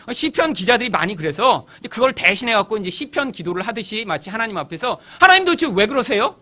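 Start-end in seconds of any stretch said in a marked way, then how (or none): 5.2-6.4 higher, louder remonstration; exasperated protest. Acted. Preacher acting out a psalmist confronting God, exasperated protest. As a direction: plead and complain upward in frustration.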